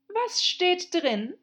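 high, slow and demanding; imperious queen voice. Acted. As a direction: press the question like a haughty queen.

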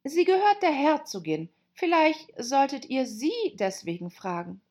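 Alice offering a way out; girl voice. A narrator's reading. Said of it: "Alice fiel nichts anderes ein".

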